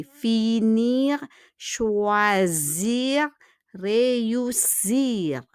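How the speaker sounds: "Finir, choisir, réussir" each drawn out syllable by syllable; careful pronunciation. Shown as a demonstration. Teacher shows how verb infinitives are said, carefully pronounced. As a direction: stretch "Finir, choisir, réussir", each ending fully sounded. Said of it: "we pronounce it carefully".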